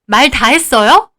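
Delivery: loud, slow confrontation; furious challenge. Acted. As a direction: sister stepping in angrily. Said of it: "얼마나 화가 났는지". (화가 나서 따져 묻는 언니)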